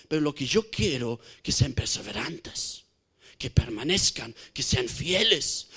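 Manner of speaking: tender, earnest plea; voicing Jesus softly. Preacher speaking as Jesus to the church, soft and low.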